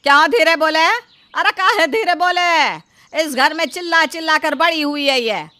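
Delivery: loud, indignant mother; defiant shouted defence of her daughter; angry loud retort. Acted. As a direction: throw the rebuke back at full volume. Mother defends shouting, refuses to speak softly.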